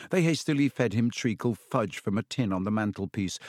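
ordinary delivery

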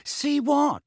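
high puzzled question; genuinely lost; confused. Acted. Ron confused.

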